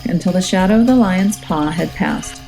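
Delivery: ordinary delivery, nothing marked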